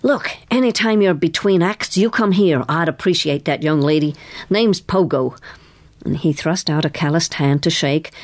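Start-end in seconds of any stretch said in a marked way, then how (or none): none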